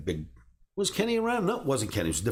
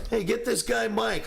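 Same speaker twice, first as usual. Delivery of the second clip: higher, calling across the floor; boss calling out; 11 semitones higher than usual. Retold or imitated. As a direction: boss hollering for the kid.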